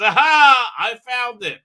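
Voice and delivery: loud, high "aha" of discovery; hammed-up eureka moment; mock triumph. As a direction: burst out triumphantly, then ease off. Voices her finding the thing.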